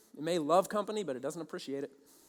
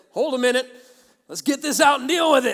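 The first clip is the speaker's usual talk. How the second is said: loud, raised, urgent command; urgent inner voice; 5 semitones higher, 11 dB louder than usual. Retold or imitated. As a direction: burst out like an alarm going off inside. Inner voice suddenly demands action.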